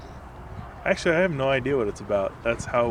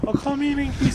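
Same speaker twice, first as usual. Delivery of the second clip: mock Chinese accent; 14 semitones higher than usual.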